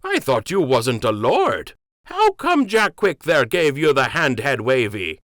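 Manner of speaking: rustic dockhand, feeling tricked; indignant docker. Acted. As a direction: accuse him of hiding his rank. Jimmy feels betrayed.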